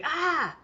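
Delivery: sharp high cry of pain; pained yelp. Retold or imitated. Brother-in-law burning his hand on the teapot, sharp high yelp. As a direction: yelp as if grabbing a hot handle. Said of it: "he was like".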